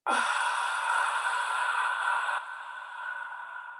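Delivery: long, soft ocean-breath exhale through the mouth; a slow hissing breath out from a narrowed throat; ujjayi exhale. Shown as a demonstration. Yoga teacher demonstrating the ujjayi exhale to students. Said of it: "it would sound like this".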